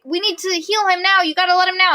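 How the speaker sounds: high-pitched mock urgency; retold urgent plea. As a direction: plead for a friend's life, acting it out.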